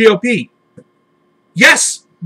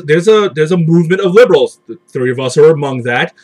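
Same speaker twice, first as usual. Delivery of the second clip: ordinary talk, nothing marked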